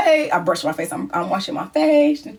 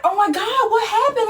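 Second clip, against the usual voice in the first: alarmed cry; 10 semitones higher than usual. Retold or imitated. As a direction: demand in frantic worry. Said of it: "So I'm like".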